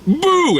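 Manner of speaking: high imitated spooky "boo"; playful retold scare cry; mock scare "boo". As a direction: jump out with a high-pitched boo. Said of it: "he scares the kid".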